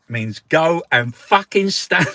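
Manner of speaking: high, loud, slow laughing outburst. Real, not acted. Cracking up while translating the rude phrase, loud and high. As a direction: blurt it out cracking up.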